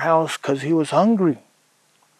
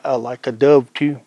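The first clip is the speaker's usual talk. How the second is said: usual speaking voice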